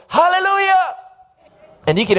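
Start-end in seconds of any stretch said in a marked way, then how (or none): 0.1-1.0 high-pitched exultant cry; old farmer's sudden joyful holler; loud shout. Retold or imitated. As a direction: shout it out as a sudden outburst of praise. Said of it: "he shouts with a loud voice".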